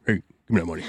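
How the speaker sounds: low, disguised robber voice. Pretend. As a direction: growl it low like a mugger. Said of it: "Alex Jones again".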